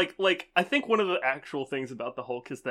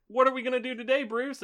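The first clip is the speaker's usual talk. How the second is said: higher, eager sidekick; lightly voiced swamp sidekick; mock sidekick voice; 10 semitones higher than usual. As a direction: pitch it up like a chipper sidekick. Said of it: "they're like".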